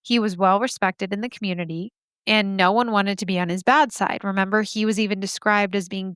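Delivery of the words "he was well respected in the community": ordinary talk, nothing marked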